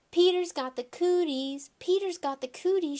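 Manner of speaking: high, loud playground taunt; yelling taunt. A narrator's reading. Girl chasing a boy on the playground, loud teasing yell. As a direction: chant it to tease him. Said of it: "she yelled".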